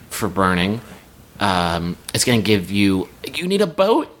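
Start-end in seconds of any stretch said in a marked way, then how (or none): 3.3-4.2 high-pitched, excited mock sales pitch; eager offer. Generous tree offering itself as a boat, eager.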